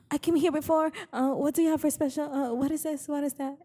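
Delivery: high-pitched mock customer, questions rattled off; imitating fussy customers. Mimicking restaurant customers quizzing the staff, high put-on fussy voice.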